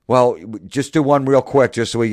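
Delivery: mock coaxing. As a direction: imitate a pushy caster wheedling. Retold casting people coaxing him to dance.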